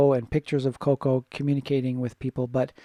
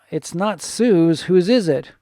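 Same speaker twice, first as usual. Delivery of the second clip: slow, louder, higher acted rebuttal; insistent mock questioning; 4 semitones higher, 6 dB louder, slower than usual. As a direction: reject the fib and press for the culprit. Acting out trainer rejecting Lucy's lie.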